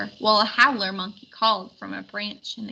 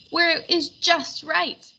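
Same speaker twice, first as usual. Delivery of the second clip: mild wail; 7 semitones higher than usual. Acted. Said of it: "wailed Wind".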